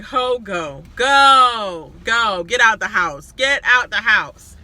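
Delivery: loud, mock yelling get out; mock eviction shout. Mock-evicting the houseguest from the house, loud shout. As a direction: shout her out the door, hammed-up. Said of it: "mock-evicting the houseguest on TV".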